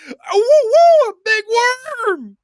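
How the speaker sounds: high, loud whooping call. Retold or imitated. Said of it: "a loud redneck".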